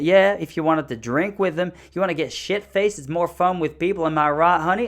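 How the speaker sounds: rowdy mock wife, rising to a loud appeal; North Dakota wife. Wife asking husband to back her up, rowdy imitation. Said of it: "And she goes".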